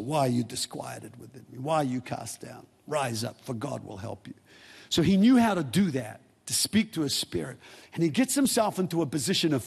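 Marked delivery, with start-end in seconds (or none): 0.0-4.3 soft psalm. Acted. Psalm spoken to own soul.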